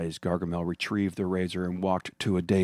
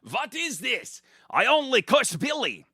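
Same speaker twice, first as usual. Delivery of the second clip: shouting. Acted. Gargamel shouting over the noise of the armed townsfolk. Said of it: "Gargamel shouted over the noise".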